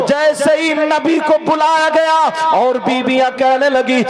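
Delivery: loud, high, impassioned sermon delivery; full force preaching. Acted. Preacher tells the crowd the Prophet's story, at full force.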